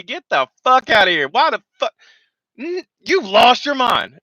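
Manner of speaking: high, loud scolding; imitating a furious gunny. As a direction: tear into the staff sergeant in front of his troops. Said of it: "and say".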